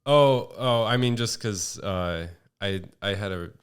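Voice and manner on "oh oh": ordinary talk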